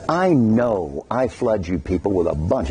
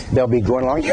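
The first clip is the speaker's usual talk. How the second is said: usual speaking voice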